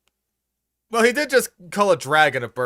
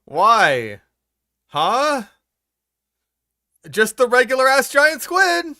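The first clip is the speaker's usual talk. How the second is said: exasperated; 8 semitones higher, 5 dB louder, slower than usual. Real, not acted. Exasperated at the video.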